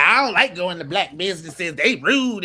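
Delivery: mock woman's voice. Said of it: "she the one that".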